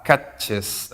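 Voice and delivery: "catches" in two distinct syllables, the added E fully sounded; clear ES ending. Shown as a demonstration. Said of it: "la E que suene fuerte y claro".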